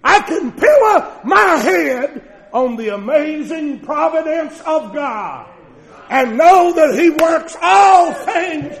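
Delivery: loud, slow, soaring proclamation; full-force preacher cry of assurance; preaching shout. Real, not acted. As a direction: ring it out with full conviction.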